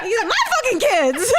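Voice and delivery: loud, high, laughing mock yell; mock outburst. Mom defending her say over her kids, loud mock outburst.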